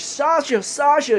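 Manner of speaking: high-pitched mock distressed cry; retold crying. Woman grabs her fallen adopted son, retold crying call. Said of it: "and she's crying".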